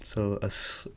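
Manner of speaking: ordinary talk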